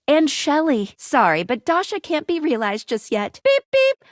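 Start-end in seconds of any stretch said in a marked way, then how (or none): 3.4-4.0 high, loud double beep; high-pitched electronic beep imitation; imitated robot beep. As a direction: beep like a sensor alert. Mimics sensor beeps in scripted lines.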